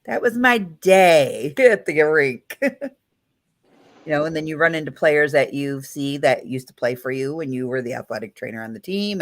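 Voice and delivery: ordinary talk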